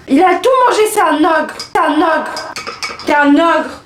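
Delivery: mock outrage. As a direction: call him an ogre in fake shock. Mock outrage at brother eating chocolate.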